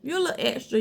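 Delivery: lightly voiced southern teasing, with a laugh; little southern voice. To viewers who want sharp brows, a light southern tease. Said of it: "gonna use my little southern voice".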